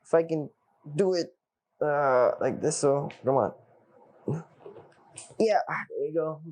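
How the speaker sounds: usual speaking voice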